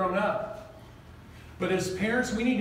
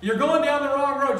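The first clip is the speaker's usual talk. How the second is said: loud call of "You're going down the wrong road."; raised warning voice; mock warning call; 4 semitones higher, 6 dB louder than usual. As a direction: shout the warning at them. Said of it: "You're like".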